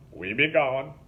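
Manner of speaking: lightly voiced boatman, pleased with himself; grinning, loud swamp drawl; gloating Link. A narrator's reading. Link grins, leaving Mickey behind.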